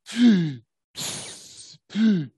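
three short puffs, the middle one only breathed; dolphin breath puffs. Retold or imitated. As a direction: imitate dolphins blowing air at the surface. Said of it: "and they go".

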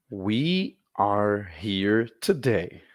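slow, clear repetition; slow teacher demo. Shown as a demonstration. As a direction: model each word slowly. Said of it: "Mais uma vez". (repetição lenta e clara)

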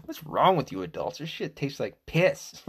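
high, mock-disgusted kid after a swig; a child spitting out beer; hammed-up childish disgust; grossed-out kid. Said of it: "And then they're like".

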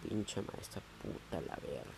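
whispered curse under the breath; muttered curse. Retold or imitated. Cursing the chemistry teacher while coloring, whispered. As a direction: hiss it to yourself so nobody hears. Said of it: "coloreando, diciendo".

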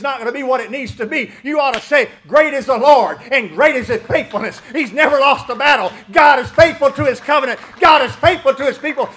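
loud, soaring preacher's cry; preaching at full force. Acted. Pastor preaching to the church, loud and soaring. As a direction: preach it hard to the congregation.

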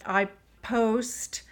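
ordinary talk

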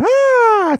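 very high-pitched "ah"; high, playful squeal; imitated baby yell. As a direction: mimic yelling with a baby. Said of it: "She was yelling at you".

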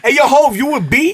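high, loud put-on call, laughing; mocking imitation. Teasing his friend with a call to [name], laughing mimicry. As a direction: mimic your friend calling out, cracking up. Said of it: "You sound like a chubby young ma".

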